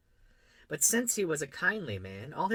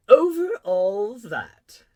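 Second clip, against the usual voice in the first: loud, very slow, drawn-out royal statement; king answering; 10 semitones higher, 15 dB louder, much slower than usual. A narrator's reading. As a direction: proclaim it grandly with falling tune. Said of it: "the king answered".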